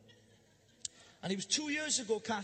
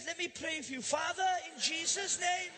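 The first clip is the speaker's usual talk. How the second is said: mock healing prayer; 7 semitones higher, slower than usual. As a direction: pray for healing like a fiery preacher hamming it up. Mock prayer over victim.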